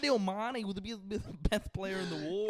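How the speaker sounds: put-on high, loud Scouse accent; Liverpool accent. Friend showing off an impression to his mate, loud Scouse accent. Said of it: "That's good Liverpoolian accent".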